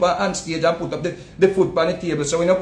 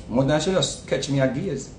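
normal talking voice

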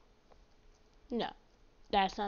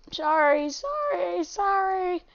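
drawn-out sorry; 7 semitones higher, much slower than usual. Pretend. Exaggerated repeated apology, playing.

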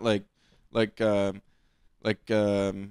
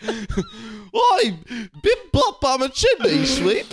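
mock cockney; 8 semitones higher, slower than usual. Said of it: "We're just like".

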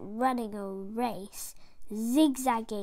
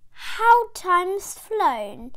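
very slow, higher flock voice; old friends marvel; slow fond greeting; 5 semitones higher, 6 dB louder, much slower than usual. Acted. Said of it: "who said".

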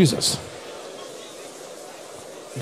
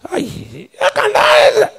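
mock outcry; much slower than usual. Mocking doubters' outcry.

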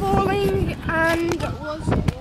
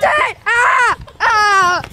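shout; 6 dB louder, much slower than usual. Real, not acted. Sprayed in the face.

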